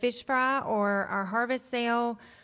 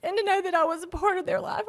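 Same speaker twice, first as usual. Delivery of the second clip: tearful, voice high and breaking; fighting back tears, pitch pushed up; holding back sobs; 7 semitones higher than usual. Real, not acted. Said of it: "I'm trying not to cry".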